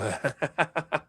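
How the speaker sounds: long monstrous belly laugh; deep bellowing laugh. Acted. Captain transforming into a devil, deep bellowing laugh. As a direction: let out a rolling, bellowing laugh. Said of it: "this deep bellowing laugh".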